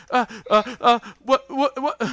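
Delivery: high, loud, stuttering panic; wild-eyed flustered babble; panicked dwarf. Acted. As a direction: stammer wildly as if cornered. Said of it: "his eyes go wild".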